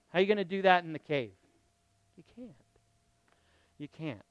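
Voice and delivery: usual speaking voice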